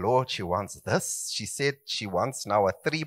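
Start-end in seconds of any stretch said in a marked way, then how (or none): 0.0-1.3 soft, slow mock plea to the Lord; a soft, slow plea, half joking; playful prayer. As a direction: whisper a sly prayer about the wife. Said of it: "and I'm saying".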